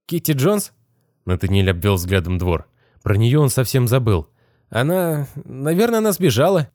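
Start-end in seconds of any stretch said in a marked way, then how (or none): 0.1-0.7 put-on high, weak voice of a dazed boy; groggy young boy, just woken; boy's voice. A narrator's reading. 4.7-6.7 put-on high, weak voice of a dazed boy; groggy young boy, just woken; boy's voice. A narrator's reading.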